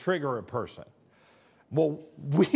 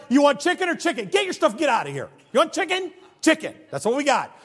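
higher and louder, impatient cashier; pretend rude cashier; 9 semitones higher, 5 dB louder than usual. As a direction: bark the order at the customer. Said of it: "I'd be like".

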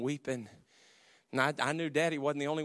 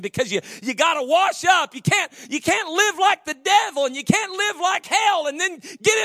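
shouted sermon line; 10 dB louder than usual. Acted. Preaching at full force.

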